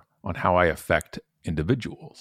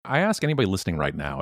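usual speaking voice

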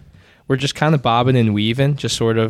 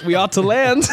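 hammy stage actor; 9 semitones higher than usual. Acted. Captain in acted scene.

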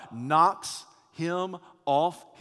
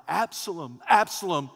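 grieving father's outcry, building louder and higher; anguished cry; 5 semitones higher, 8 dB louder than usual. Acted. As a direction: cry out for a lost son. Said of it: "he cries out".

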